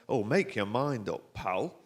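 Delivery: slow, loud Yorkshire grumble; Yorkshire-accented lift passenger. Acted. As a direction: complain in broad Yorkshire. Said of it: "it's the only regional accent I can do".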